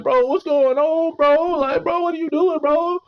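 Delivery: high, drawn-out mock complaining voice; imitating how players talk. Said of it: "that's how they talk".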